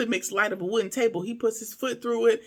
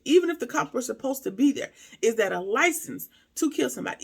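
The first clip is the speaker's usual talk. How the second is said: ordinary talk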